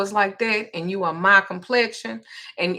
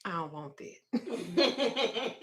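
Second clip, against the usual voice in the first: laughing refusal; 11 dB quieter, much slower than usual. Retold or imitated. Laughing refusal to shop clerk.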